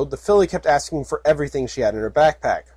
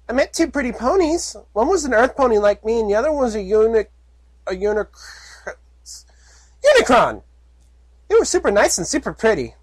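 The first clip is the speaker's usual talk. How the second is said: high, guileless filly voice; dreamy filly; 9 semitones higher than usual. Acted. Filly telling about two pretty ponies, high and guileless. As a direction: gush like a naive little kid. Puppy voice held.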